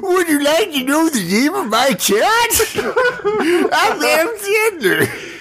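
high goofy comedian voice, laughing; hammed-up eldritch comedian; Adam Sandler voice. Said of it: "Adam Sandler as an Eldritch Horror".

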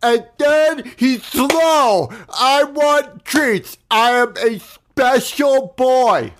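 high, very slow silly voice; Red as a simpleton. Pretend. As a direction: play a childlike dope, drawing out each word. Playing Red as a slow character.

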